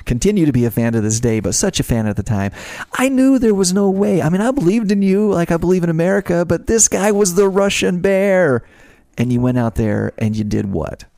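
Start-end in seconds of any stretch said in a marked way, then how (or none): 3.0-8.6 excited. Real, not acted. Host gushing about the famous match.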